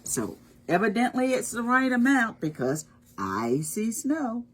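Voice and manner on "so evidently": ordinary delivery, nothing marked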